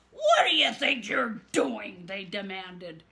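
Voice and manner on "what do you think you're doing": loud, angry challenge; furious demand. Acted. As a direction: demand it with fury. Furious swamp creatures.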